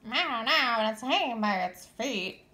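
lightly voiced chirping chick; a hint of a chirping bird; chirpy baby bird. A narrator's reading. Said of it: "chirped Flitter".

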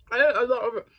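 talking with a mouthful; words round a mouthful of food; mouth full. Real, not acted. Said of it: "Let me not eat with my mouth full".

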